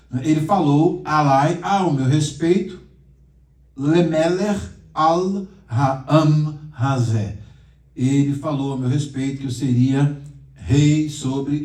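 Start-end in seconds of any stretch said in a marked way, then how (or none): 3.7-7.4 Hebrew phrase drawn out word by word, a bit louder; teacher slowly spelling out Hebrew; slow Hebrew reading. Shown as a demonstration. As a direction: read "Lemeler al Ha'am Hazé" slowly like dictating to students.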